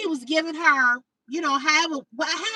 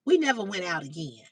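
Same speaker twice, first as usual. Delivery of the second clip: ordinary talk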